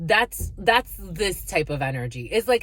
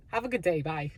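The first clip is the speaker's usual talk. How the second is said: softer, trailing mock goodbye; fake polite brush-off; 3 semitones lower, 6 dB quieter than usual. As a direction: dismiss them sweetly, fading out.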